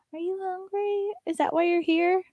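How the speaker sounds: high, sing-song pet voice. Real, not acted. To her dog Muffin, high cooing baby talk. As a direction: coo at the dog.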